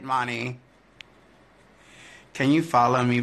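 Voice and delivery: ordinary talk, nothing marked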